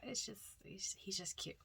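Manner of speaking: soft unvoiced gush over a cute cat; whisper. Real, not acted. Thrift shopper whispering over Grumpy Cat dishes, adoring hush.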